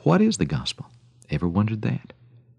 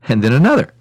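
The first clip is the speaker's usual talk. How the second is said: higher, louder mounting repetition; escalating; 4 semitones higher, 8 dB louder, faster than usual. A narrator's reading. Storyteller counting bunch after bunch of grapes, escalating. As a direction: build the amazement as it piles up.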